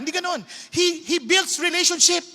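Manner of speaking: ordinary delivery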